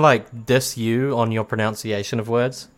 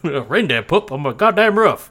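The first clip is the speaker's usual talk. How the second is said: mock Southern homeowner; 6 semitones higher, 4 dB louder than usual. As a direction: echo the yokel's outrage in a Southern twang. Echoes the Southern roof bit.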